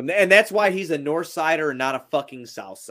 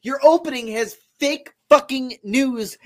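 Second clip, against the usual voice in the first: raised, higher voice in protest; indignant rant; 6 semitones higher, 4 dB louder than usual. Real, not acted. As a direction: argue back heatedly against a false charge. Heated denial, attacking the accusation.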